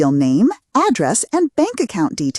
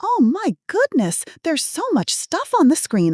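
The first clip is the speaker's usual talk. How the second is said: put-on flustered old lady; 9 semitones higher than usual. Fake granny staring at the screen, high and flustered. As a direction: gasp it out like a bewildered grandma. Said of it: "I maintained my confused elderly woman role".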